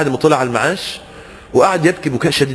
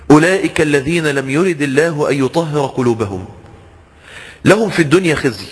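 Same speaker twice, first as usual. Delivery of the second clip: Quran recitation; 2 semitones lower than usual. Acted. Quran verse recited again.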